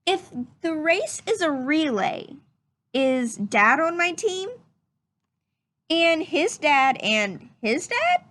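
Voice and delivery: lively, curious rising questions; questioning read-aloud voice. Acted. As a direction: read each question like a wondering child. Reads story questions aloud.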